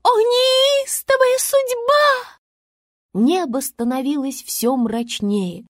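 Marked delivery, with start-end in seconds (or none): 0.0-2.4 высоко, медленно, надрывно; драматично сетует на судьбу; театральные причитания. A narrator's reading. Звезда причитает. (Звезда трагически причитает, медленно)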